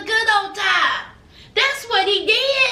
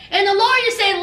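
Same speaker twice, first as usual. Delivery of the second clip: ordinary talk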